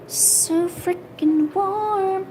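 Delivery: high, drawn-out savouring; blissful relishing. Real, not acted. To self in the warm vacated chair, blissful relishing. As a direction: stretch it out slowly, high and blissful.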